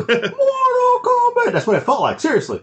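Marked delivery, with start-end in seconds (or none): 0.1-1.5 very high, drawn-out yell; hammed-up action anthem cry; mimicked game shout. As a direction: stretch it into a high theme-song scream. Imitates game theme shout.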